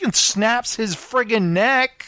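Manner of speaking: ordinary delivery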